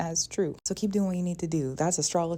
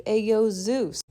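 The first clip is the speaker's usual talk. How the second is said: mock street-style call to Zeus; comedic praying mom; 4 semitones higher, 6 dB louder, slower than usual. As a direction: call out loudly, casually, for laughs. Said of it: "I doubt she actually talked like that".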